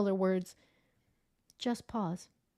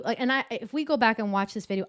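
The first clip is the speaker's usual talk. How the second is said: normal talking voice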